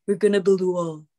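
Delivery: lightly voiced Trump; a hint of Donald Trump; Trump impression. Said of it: "before you can impersonate it yourself".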